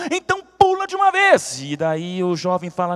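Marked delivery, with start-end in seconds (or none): 0.1-1.6 much higher, insistent push to jump; exasperated order. Acted. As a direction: snap at the young man to jump now.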